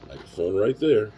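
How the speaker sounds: showing off loudly. Real, not acted. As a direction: announce the mic proudly.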